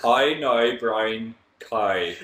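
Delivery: Hi now brown cow drawn out very slowly, each vowel stretched; slow elocution drill. Shown as a demonstration. Friends mock a posh elocution drill, very slowly. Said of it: "do the how now brown cow method".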